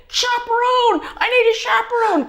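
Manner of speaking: high pitched hammed-up calling out; mock panicked call. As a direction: call out for a chaperone like a panicking doctor. Imitating doctor calling out.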